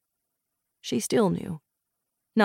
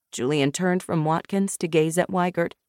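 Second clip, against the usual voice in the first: ordinary delivery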